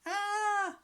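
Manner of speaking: high, loud startled scream; frightened lump screaming. A narrator's reading. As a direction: shriek back in fright. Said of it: "screamed the lump".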